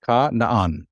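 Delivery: "Ka naan" with a hard K and a separate first syllable; hard kaf pronunciation. Shown as a demonstration. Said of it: "this would be a hard".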